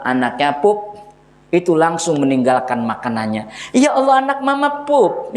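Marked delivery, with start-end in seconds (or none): 3.8-5.3 doting mother. Retold or imitated. Mother fusses over child.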